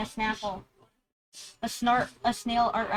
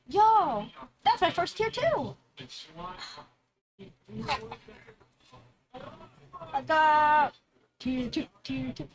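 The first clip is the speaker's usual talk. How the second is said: excited; 4 semitones higher, slower than usual. Real, not acted. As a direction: shout it out, thrilled. Excited first tier two sub.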